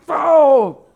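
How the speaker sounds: loud, high mock gunshot bang; big high bow of a pistol firing; imitated gunshot. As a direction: blurt a cartoon gun blast. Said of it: "I go".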